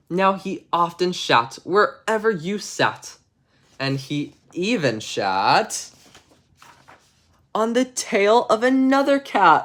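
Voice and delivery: slow, measured bedtime-story reading; story narration. Acted. Streamer reading a bedtime story to chat, storybook narration. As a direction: read it like a storybook to kids.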